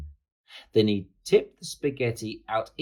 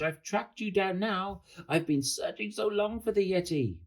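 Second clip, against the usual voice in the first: high-pitched eager announcement, no real shouting; excited exclamation; 10 semitones higher than usual. A narrator's reading. George tells the monster he's tracked it down, high excitement. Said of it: "shouted George".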